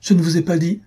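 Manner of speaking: high-pitched embarrassed protest; flustered exclamation. A narrator's reading. Young girl blushing over her secret, high flustered protest. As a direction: cry out in protest, pitch jumping up. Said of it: "s'écria Minella en rougissant".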